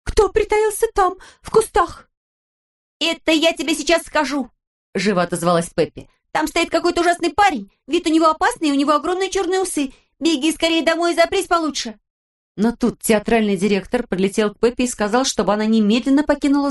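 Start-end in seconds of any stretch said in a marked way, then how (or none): 0.0-2.1 high, slow fearful question; frightened. A narrator's reading. Countess hearing rustling, asking in fright. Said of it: "спросила с испугом в голосе". 3.0-12.0 put-on high, lively girl's voice; Pippi voice. A narrator's reading. Narrator voicing Pippi, high and perky.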